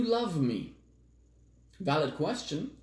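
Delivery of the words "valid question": usual speaking voice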